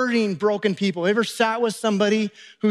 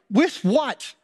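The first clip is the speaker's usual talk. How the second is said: higher-pitched, baffled comeback; incredulous disciples; 4 semitones higher than usual. Acted. Disciples answering Jesus' impossible request, incredulous. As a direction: throw the question back in disbelief.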